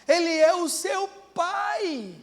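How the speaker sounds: preaching at full force; emphatic shout. Real, not acted. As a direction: drive it home to the crowd. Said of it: "repeated for emphasis".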